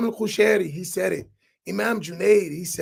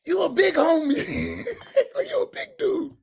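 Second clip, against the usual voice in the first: teasing slang impression; 8 semitones higher than usual. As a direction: tease him in a high voice, breaking into laughter. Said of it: "How, how May say".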